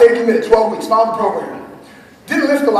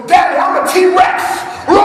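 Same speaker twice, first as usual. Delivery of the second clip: little son as a T-Rex; 10 semitones higher, 6 dB louder than usual. Retold or imitated. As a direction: play a small boy roaring like a T-Rex. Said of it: "my son goes".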